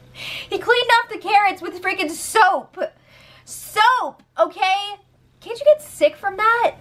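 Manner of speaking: high, slowed disbelief at the soap; high-pitched appalled "Soap"; shocked disbelief. Real, not acted. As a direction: sound scandalized.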